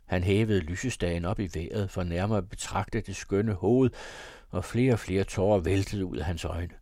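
usual speaking voice

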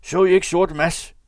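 high, loud call to riders approaching; råb. A narrator's reading. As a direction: shout the question out ahead of you. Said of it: "råbte han, endnu før han kom dem nær". (råbende)

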